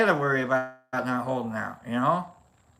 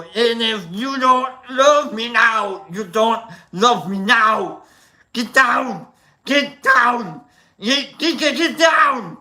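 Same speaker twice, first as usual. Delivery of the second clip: loud, higher Arnold Schwarzenegger singing; 6 semitones higher, 6 dB louder, slower than usual. Pretend. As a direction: sing it like Arnold, pumped up. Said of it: "I like Arnold".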